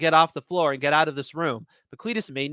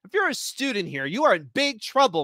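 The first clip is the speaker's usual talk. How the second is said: stern coughing; 7 semitones higher than usual. Acted. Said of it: "I coughed".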